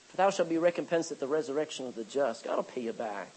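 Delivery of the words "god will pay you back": ordinary talk, nothing marked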